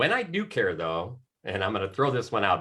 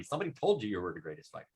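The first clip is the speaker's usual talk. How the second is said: normal talking voice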